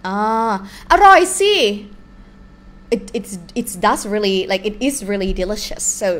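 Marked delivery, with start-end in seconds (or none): 0.9-1.5 อร่อยสิ said much higher and louder; emphatic อร่อยสิ. Shown as a demonstration. Teacher showing how to insist food is delicious, emphatic. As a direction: burst out อร่อยสิ with gusto. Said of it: "use the word สิ to emphasize".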